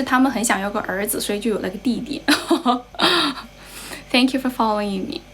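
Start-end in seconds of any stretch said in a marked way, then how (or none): 2.2-3.4 short burst of laughter; laughing. Real, not acted. Streamer laughing about why she has a brother, laughing.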